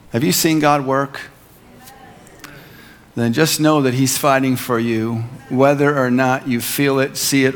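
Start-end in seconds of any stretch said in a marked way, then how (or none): none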